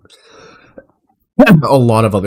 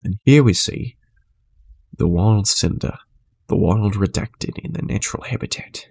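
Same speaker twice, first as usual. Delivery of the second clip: hushed wildlife narrator, softer; nature documentary; 3 semitones lower, 6 dB quieter than usual. Pretend. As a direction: narrate like a nature show host. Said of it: "Animal Planet is fucking crazy".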